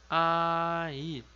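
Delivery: A, then I, each sounded separately; teacher modelling A and I slowly for students; Korean vowel demo. Shown as a demonstration. Teacher to students, a clear modelling tone. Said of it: "Então fica".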